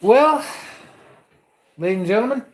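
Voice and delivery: rising, showy host welcome; announcer voice. Acted. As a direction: open the show like an emcee. Show host opening.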